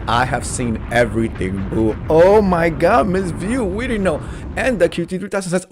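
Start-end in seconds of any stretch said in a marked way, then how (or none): 2.1-4.2 mock gasp. Mock shock at viewer comment.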